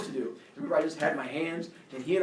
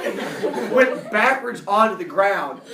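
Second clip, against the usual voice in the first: ordinary delivery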